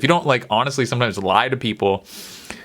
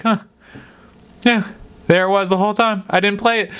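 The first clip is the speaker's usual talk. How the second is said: lightly voiced grandma, pitched up; imitated grandma; 7 semitones higher than usual. Grandma owning up to missing the play, lightly put-on higher voice. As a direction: play grandma shrugging off her slip. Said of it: "She'd be like".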